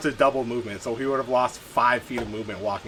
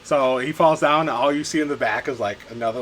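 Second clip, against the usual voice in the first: ordinary talk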